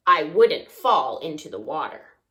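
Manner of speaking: plain reading voice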